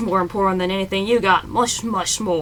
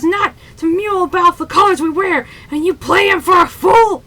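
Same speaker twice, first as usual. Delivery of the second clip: high, loud angry shouting; captain berating the drunk sailor, shouting; furious captain; 8 semitones higher, 8 dB louder than usual. Acted. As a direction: rage at the sailor.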